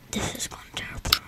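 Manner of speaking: fast whispered aside; whispering. Real, not acted. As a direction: whisper it to yourself, quickly. Aside while game goes badly.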